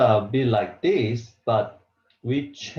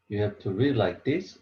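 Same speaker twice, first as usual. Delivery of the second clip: ordinary talk, nothing marked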